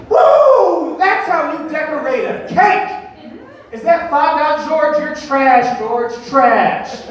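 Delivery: loud, high, gloating yells at a rival; hammed-up trash-talking American contestant; gloating shout. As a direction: crow over the others like a brash winner.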